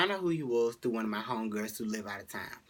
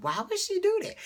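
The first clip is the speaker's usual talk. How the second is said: much higher, louder indignant question; outraged friend; 6 dB louder than usual. Retold or imitated.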